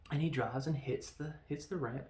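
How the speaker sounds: ordinary talk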